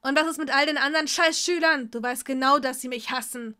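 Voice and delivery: loud, fast furious yelling; angry shout. Acted. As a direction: spit it out in rage. Draco yells at Tom.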